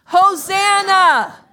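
Hosanna drawn out, loud and high; full shout. Shown as a demonstration. Pastor leading the congregation in a shout, long loud Hosanna. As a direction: shout it from the top of your lungs. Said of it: "on the count of three, we're gonna shout".

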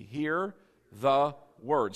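"Hear the word" slow, word by word; leading in unison. Shown as a demonstration. Preacher leading everybody to say it, slow and spaced. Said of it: "Everybody say it with me".

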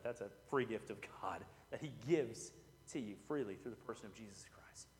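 softly, almost breathed, reverent; hushed aside. Real, not acted. Pastor hushes to the church about a gift, whispering. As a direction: drop to a near whisper.

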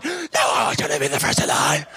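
mock angry shout, shoved out. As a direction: bark the line like a fight. Acting an angry quarrel.

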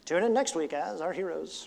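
vintage radio announcer; old-timey radio voice. Retold or imitated. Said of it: "that old-timey radio voice".

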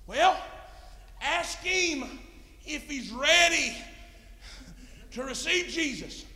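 high, loud, drawn-out urging; excited and anxious. Retold or imitated. Preacher urges a translator to ask the man, excited. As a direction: press the question with eager force. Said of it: "I got excited and anxious".

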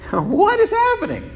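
high, squeaky astonished question; mock bewilderment. Preacher mocking his own confusion, high astonished squeak. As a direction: squeal it out in disbelief.

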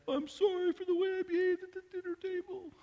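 put-on high little-kid voice, sheepish; a child apologizing to dad for misbehaving, in a high little voice; small child's apology. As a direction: squeak out an apology like a five-year-old.

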